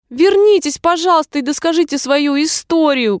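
high, pleading call; calling after. A narrator's reading. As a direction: call out after someone walking away. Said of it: "крикнула ей вслед Алиса".

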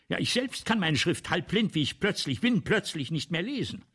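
deutlich lauter und höher, sich in Rage reden; erregter Ausbruch. Acted. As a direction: aufgebracht über die eigene Halbblindheit. Autor gerät über Schrift in Rage. (lauter, hoher, erregter Ausbruch)

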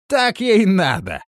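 high, loud, drawn-out gloating; cheerful. A narrator's reading. Fellow approving of Biota's fate, cheerful verdict. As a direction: say it with cheerful satisfaction. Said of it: "ответил жизнерадостный жердяй".

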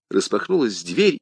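plain reading voice

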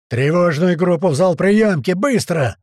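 barked order. A narrator's reading. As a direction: give orders on the run. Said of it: "начал на ходу отдавать распоряжение Туман". (громкий срочный приказ)

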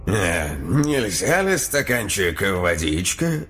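slow, polite odd demon; friendly demon voice. A narrator's reading. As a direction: ask it kindly, otherworldly. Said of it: "доброжелательным тоном".

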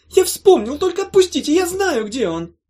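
high, loud panicked squeal; cornered little creature blurting excuses; terrified creature. Acted. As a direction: plead in a tiny frantic voice.

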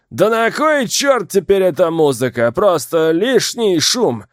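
put-on loud, high drunken shout; drunken yell. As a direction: bellow it like a drunk lout. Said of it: "заорал пьяным голосом".

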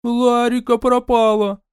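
high, slow, desperate cry; panicked crying mother. Acted. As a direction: burst in wailing with fear. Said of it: "прибежала вся в слезах".

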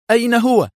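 very high, loud urgent question; agitated interruption. A narrator's reading. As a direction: burst in, voice leaping up. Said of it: "قاطعه أدهم هذه المرة في انفعال". (سؤال منفعل بصوت عال)

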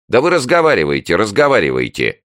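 high, loud, fast call-out; storming off shouting. A narrator's reading. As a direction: call back while walking away. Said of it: "крикнула заведующая уже из коридора".

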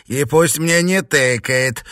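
loud, high-pitched haughty order; offended witch mistress; imperious demand. Acted. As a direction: insist on due respect.

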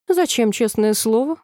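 plain reading voice

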